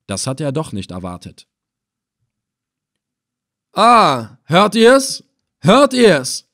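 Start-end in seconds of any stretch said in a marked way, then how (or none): 3.7-6.5 high, loud exclamations to the crowd; triumphant calling out. A narrator's reading. Captor calls his men to witness confession, triumphant calling out. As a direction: crow it out to your men. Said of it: "rief er dann".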